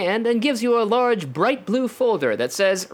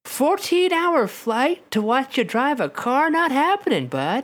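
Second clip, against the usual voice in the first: lightly voiced dad, pitched up; mock dismissive dad; 6 semitones higher than usual. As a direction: refuse like a dad brushing off his kid. Acting out the absent dad's refusal.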